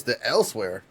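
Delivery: ordinary delivery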